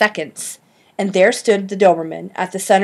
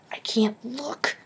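slow, softer whispered moan; frightened whispered moan; fearful moan; 5 semitones higher, 7 dB quieter, slower than usual. A narrator's reading. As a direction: moan it, eyes covered. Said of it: "moaned Ben".

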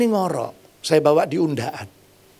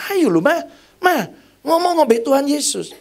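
pleading; 8 semitones higher than usual. Retold or imitated. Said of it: "pleading with dying mother".

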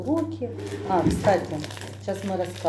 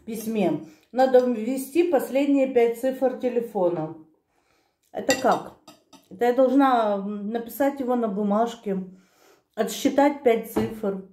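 chewing between words; speaking with food nearby; talking while eating. Real, not acted. Seen 1: Holds bitten bun throughout, chews between phrases, lifts it to mouth.

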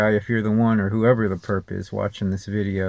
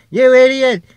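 much higher, much louder radio-host yell; Mark Levin; 14 semitones higher, 10 dB louder than usual. Retold or imitated. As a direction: bark it like an angry talk-show host hanging up on a caller. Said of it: "I wish I could talk like him when he gets down there and go".

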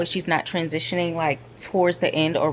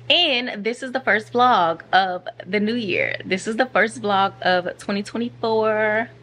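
ordinary talk, nothing marked

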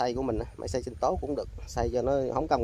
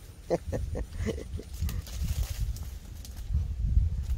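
breathy laugh; 5 semitones higher, 4 dB quieter than usual. Real, not acted. Laughing after fish escapes.

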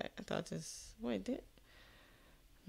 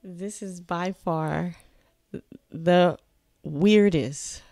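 drawn-out, each word hit hard; slow dramatic pauses; 4 dB louder, much slower than usual. Real, not acted. Reacting to a bizarre film, slow and punched. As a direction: build it up word by word for effect. Reactor drawing out his verdict.

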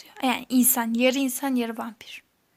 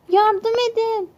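high, slow, louder wailing plea; mock plea for help; 9 semitones higher, 7 dB louder, slower than usual. Trapped player wailing for help. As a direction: whine it like a hurt child. Caught in a trap.

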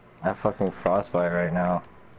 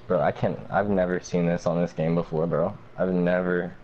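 ordinary talk, nothing marked

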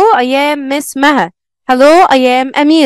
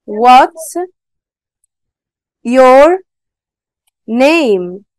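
What's, your, name spelled out one at a time; word by word; 2 semitones lower, much slower than usual. Shown as a demonstration. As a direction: pause between each word for learners. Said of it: "أو W capital؟".

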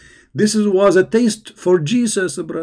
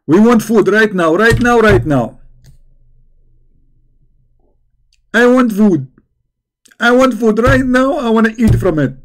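higher, louder whiny demand for food; stubborn disciples; 5 semitones higher, 3 dB louder than usual. Acted. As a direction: pester like hungry stubborn kids. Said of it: "Okay, we are stubborn".

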